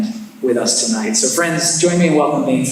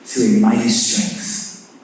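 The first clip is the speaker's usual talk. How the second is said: hushed congregational prayer; 4 semitones lower, 3 dB quieter than usual. Acted. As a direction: pray it quietly and reverently, dropping low. Opening prayer before introducing speaker.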